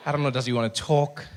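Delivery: ordinary talk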